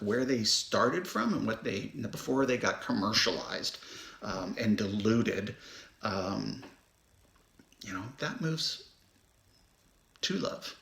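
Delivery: usual speaking voice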